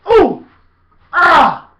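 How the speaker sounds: high, loud startled cry; mock startled yell. Shown as a demonstration. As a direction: jump back with a shout. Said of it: "you think that I'm gonna".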